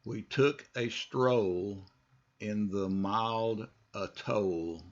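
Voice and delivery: slow repeat in two chunks. Shown as a demonstration. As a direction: break it up and say it slowly. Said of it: "just follow me".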